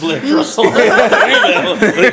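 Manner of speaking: high, loud, slow fake French through laughter; laughing mock French. Joking with cohosts, laughing mock French. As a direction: ham up a silly French line. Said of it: "Oh, it was".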